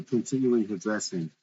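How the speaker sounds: ordinary delivery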